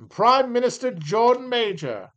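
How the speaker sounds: mock British accent, slow. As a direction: announce the PM like a proud Brit. Mock British reading of entry.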